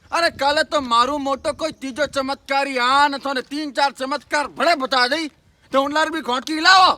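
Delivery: loud harangue rising to a shout; heated rebuke. Acted. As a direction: build the scolding to a high, quick shout. Shepherd berates the cleric's followers. (ज़ोर से फटकारते हुए)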